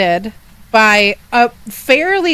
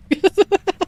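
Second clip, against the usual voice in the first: scream into giggles; 9 semitones higher than usual. Real, not acted. Startled by someone, laughing.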